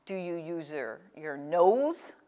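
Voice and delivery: ordinary talk, nothing marked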